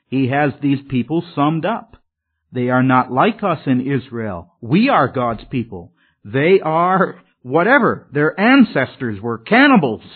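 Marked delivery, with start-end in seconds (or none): none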